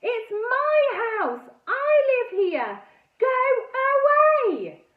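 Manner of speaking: loud, high, slow yell. A narrator's reading. Girl yelling at the buyers, top of her voice. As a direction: shout down at them with all you've got. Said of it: "I yelled at the top of my voice".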